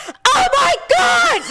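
high laughing scream of panic; screamed into the phone. Retold or imitated. Mom shrieks into the phone at the school. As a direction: shriek it in shocked dismay. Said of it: "I screamed into the phone".

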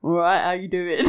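low mock-bloke greeting; man voice. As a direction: put on a deep man's voice, amused. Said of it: "do a man voice".

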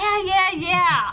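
high, loud brush-off to mom; exasperated daughter. Acted. Daughter brushing off her mother's church reminder, exasperated holler. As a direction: yell back that you heard already.

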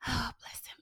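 whispered fond aside; affectionate whispered remark. Real, not acted. Laughing aside about husband.

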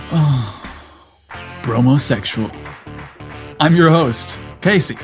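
ordinary delivery, nothing marked

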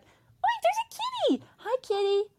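very high squeal of cat delight; kitty greeting. Real, not acted.

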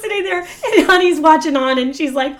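ordinary talk, nothing marked

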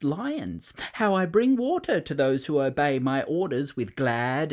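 a boastful little king announcing his plan; enthusiastic king. A narrator's reading. King addressing the island animals, grand and pompous. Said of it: "addressed them with unusual enthusiasm".

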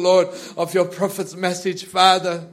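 usual speaking voice

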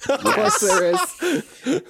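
mock echo. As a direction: drag it out, amused and teasing. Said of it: "Everything's themed".

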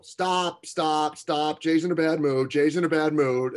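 loud, high-pitched call across the room; yelling. Retold or imitated. Players warning each other around the weight room, yelling. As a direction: holler it out as a warning to everyone. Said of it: "and just yell".

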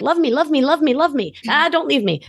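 high desperate clingy plea; clingy pleading. Retold or imitated. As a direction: beg them not to leave, high.

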